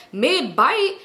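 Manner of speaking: usual speaking voice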